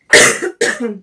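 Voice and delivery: a sudden loud throat-clearing cough; real cough. Real, not acted. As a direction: cough from a cold, caught off guard. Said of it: "I got something in my throat".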